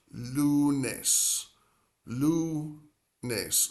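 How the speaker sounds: lunes split slowly into LOO, NESS; syllable by syllable. Shown as a demonstration. Spanish teacher showing the two syllables of Monday, syllable by syllable. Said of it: "Two syllables".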